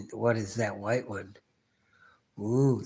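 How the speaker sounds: normal talking voice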